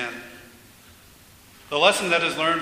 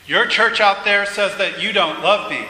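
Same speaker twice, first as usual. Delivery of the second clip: louder, higher, emphatic complaint; anguished protest; 4 semitones higher, 8 dB louder than usual. Acted. Man telling God his church condemns him, protesting loudly. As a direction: protest it forcefully, voice raised.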